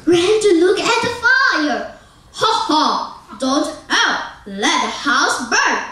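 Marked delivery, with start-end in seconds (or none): none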